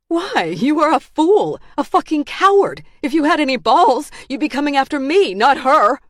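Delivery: high, loud tirade; furious taunting. Acted. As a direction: goad him into attacking you. Bound woman goads her captor.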